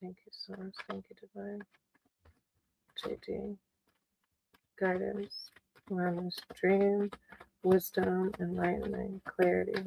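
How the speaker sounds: hushed, murmured invocation to spirit; whispered prayer. Real, not acted. Tarot reader asks Divine for guidance on JD's dream, whispered. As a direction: pray softly under the breath, asking for guidance. Calls on higher power.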